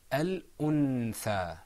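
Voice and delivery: "al-untha" slowly, with a clear n; "al-untha", slow, distinct n before th; without ikhfa. Shown as a demonstration. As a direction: articulate the noon fully in "al-untha". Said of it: "is pronounced without ikhfa".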